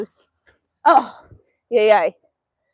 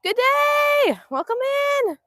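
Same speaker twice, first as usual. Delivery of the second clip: high lilting livestream greeting; sing-song welcome; 12 semitones higher, slower than usual. Real, not acted. Vlogger greeting viewers joining the stream, high sing-song.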